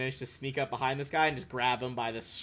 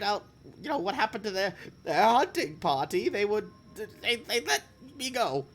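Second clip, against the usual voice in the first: goblin hiccuping through frightened speech; 9 semitones higher, 4 dB louder than usual. Acted. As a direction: hiccup between the words. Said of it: "I was, like, hiccuping through that whole sentence".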